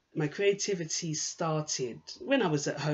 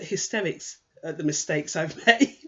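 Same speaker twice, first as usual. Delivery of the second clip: real laugh breaking into the words, louder; amused laughing; 2 semitones higher, 8 dB louder than usual. Real, not acted. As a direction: laugh at your own mistakes as you speak. Laughing at own mistakes.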